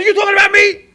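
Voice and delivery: indignant lady. Retold or imitated.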